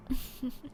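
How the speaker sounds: a shaky little laugh mid-whisper; a chuckle slipping out of a whisper; breathy chuckle. Acted. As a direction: laugh under your breath. Vampire losing control.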